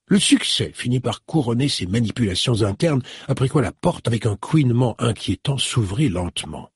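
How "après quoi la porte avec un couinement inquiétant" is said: ordinary delivery, nothing marked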